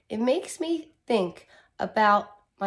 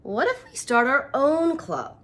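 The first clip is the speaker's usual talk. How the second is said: put-on higher, louder child's question; eager suggestion; 5 semitones higher, 6 dB louder than usual. Reader voicing the girl proposing their own club. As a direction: pitch it up like an excited kid.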